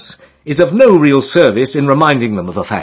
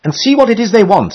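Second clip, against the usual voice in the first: put-on a higher girlish voice; girl's voice; 8 semitones higher than usual. A narrator's reading. One girl sending Emily back, lightly voiced girl. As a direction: speak like one of the towing girls. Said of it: "says one".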